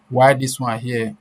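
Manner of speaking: normal talking voice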